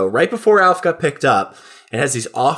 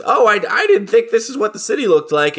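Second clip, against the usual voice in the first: lightly pitched-up character voice; naive turkey; 7 semitones higher than usual. Acted. Turkey tells the cats about his city letdown, lightly voiced character. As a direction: voice a puzzled newcomer, a bit hammy.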